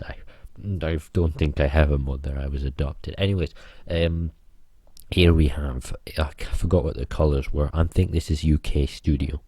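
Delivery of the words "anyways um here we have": usual speaking voice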